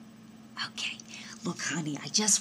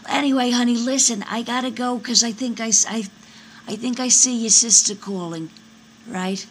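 higher, loud, hurried excuse; loud brush-off; 5 semitones higher, 9 dB louder than usual. Acted. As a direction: cut in loudly to end the call. Mother abruptly excuses herself from call.

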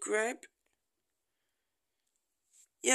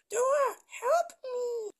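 pretend cry for help; 12 semitones higher, 6 dB louder than usual. Stuck in bush, calls for help.

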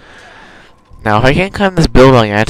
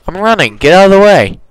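higher, excited outburst while running; excited yelling; 9 semitones higher than usual. Real, not acted. Running from guards, excited shouting. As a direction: yell out, pushing through the crowd.

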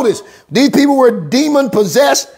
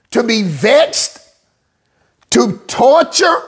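ordinary delivery

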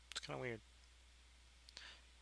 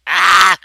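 short, very loud high scream; sudden high-pitched yelp; startled yell; 8 semitones higher, 25 dB louder than usual. Real, not acted. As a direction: cry out as if suddenly trapped. Gets encircled in the game.

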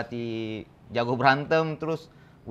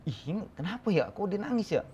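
higher-pitched retold bewilderment; puzzled wondering; 7 semitones higher than usual. Owner recalls wondering why the dog cries, puzzled. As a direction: wonder to yourself in a raised pitch. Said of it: "saya di situ heran tuh".

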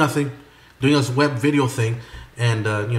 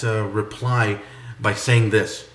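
normal talking voice

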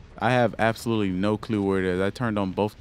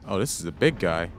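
usual speaking voice

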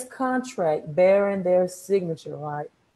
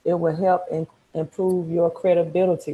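normal talking voice

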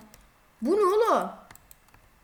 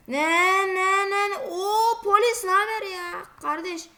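ordinary talk, nothing marked

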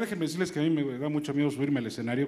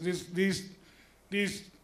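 stuttering doctor; 3 semitones higher, 4 dB quieter, much slower than usual. Acted. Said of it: "¿Cómo me sale, verdad?".

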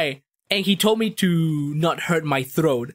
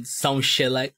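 ordinary talk, nothing marked

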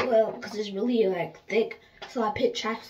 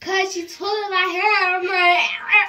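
whiny; 8 semitones higher, 9 dB louder than usual. Real, not acted. Complaining hair is pulled.

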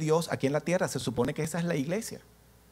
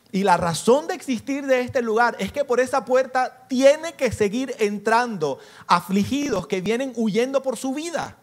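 higher and louder, pressing the point home; fervent preaching; 7 semitones higher, 6 dB louder than usual. Acted. Pastor urging the church at full preaching force. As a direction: preach it out to the congregation.

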